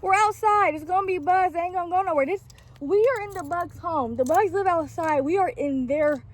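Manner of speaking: reassuring mom. Real, not acted. Mom calming a screaming child.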